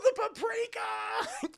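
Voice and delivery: high, slow mock yell of the punchline; mock shout. Retelling a horror movie to chat, mock shout. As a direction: belt out the last word like a battle cry.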